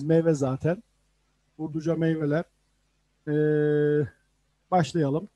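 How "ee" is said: ordinary delivery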